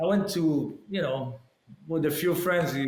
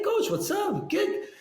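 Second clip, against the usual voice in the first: coach's hearty greeting; slower than usual. Retold or imitated.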